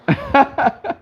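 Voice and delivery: high burst of laughter; a startled high laugh; sudden laugh. Real, not acted. Laughs at chat comment.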